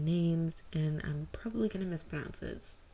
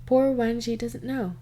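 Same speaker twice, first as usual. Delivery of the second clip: higher and louder, comic weariness; punchline read; 5 semitones higher, 7 dB louder than usual. Acted. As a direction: land the joke with a dramatic sigh. Summary punchline.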